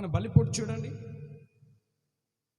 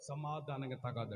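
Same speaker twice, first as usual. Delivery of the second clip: ordinary talk, nothing marked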